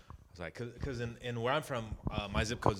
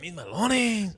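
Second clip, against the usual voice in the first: imitating Freddie; 9 semitones higher, 4 dB louder, slower than usual. Shown as a demonstration. Friend asks him to say the name again, imitating Freddie. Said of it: "say Miss Malones again".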